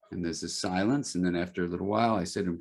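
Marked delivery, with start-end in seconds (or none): none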